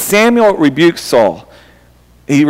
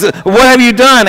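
Samuel, stern rebuke; 6 semitones higher than usual. Retold or imitated. Prophet Samuel demanding an answer from disobedient Saul. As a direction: lean into it like a shocked prophet. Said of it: "asked Samuel".

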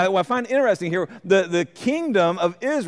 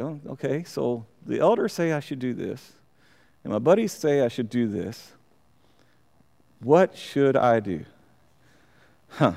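puzzled king thinking aloud; 4 semitones lower, 4 dB quieter than usual. Acted. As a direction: mull it over quietly, unsure which way to go. King voiced weighing two advices.

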